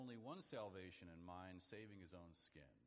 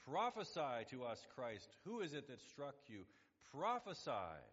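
loud, higher demand from a jeering crowd; acted taunting shout; 5 semitones higher, 8 dB louder than usual. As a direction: call it out like an accuser in the crowd. Voices the crowd taunting Jesus.

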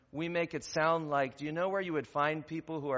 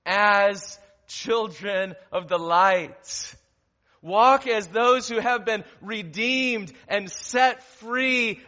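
loud, slow, soaring sermon proclamation; preaching at full force; 4 semitones higher, 9 dB louder, slower than usual. Acted. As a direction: proclaim each phrase like a call from the pulpit.

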